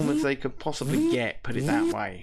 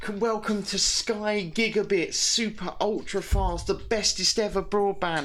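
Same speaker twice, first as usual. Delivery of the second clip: mock broadband advert; 7 semitones higher than usual. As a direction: ham up a cheesy ad announcer. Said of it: "it said to us".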